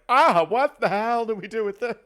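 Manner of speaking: loud, high exasperated cry; exasperated outburst. Retold or imitated. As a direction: throw up your hands in dismay. Everyone reacting to her hair.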